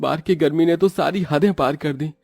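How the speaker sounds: dhaba owner. Acted. Dhaba owner complains about heat.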